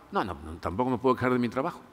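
soft, low, quick brush-off; guarded denial. Acted. Cautious citizen answering government official, mildly guarded denial. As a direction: deny it quickly and softly, lightly acted.

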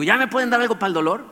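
high, loud, impatient begging; exasperated plea. Retold or imitated. Patient begs the doctor for painkillers, high exasperated plea.

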